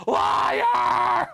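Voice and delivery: loud high shouted Liar; mock shout. Panhandler yells at a shopper who says no cash, loud mock shout. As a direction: fling the accusation out in a yell.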